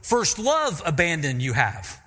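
normal talking voice

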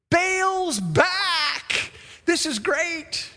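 high, laughing cheer; the people cheering Baal's return, laughing; mock delight. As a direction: play the fickle crowd, delighted. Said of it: "what do you think the people's response".

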